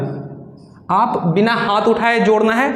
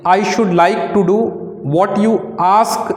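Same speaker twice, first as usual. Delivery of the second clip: slow dictation; much slower than usual. Shown as a demonstration. Dictating shorthand exercise.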